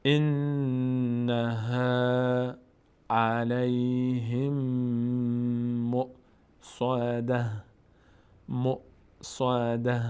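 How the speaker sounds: very drawn-out, evenly pitched syllables; slow recitation demo. Shown as a demonstration. Teacher modelling the verse for learners, slow recitation. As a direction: recite slowly with long held sounds.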